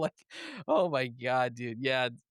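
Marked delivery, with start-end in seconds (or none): none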